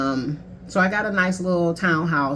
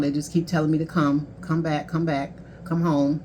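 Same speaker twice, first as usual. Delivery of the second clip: ordinary talk, nothing marked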